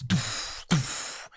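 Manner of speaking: soft, airy mouth booms; muffled explosion sound effect. Acted. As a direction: imitate distant grenades going off. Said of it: "just as you hear this".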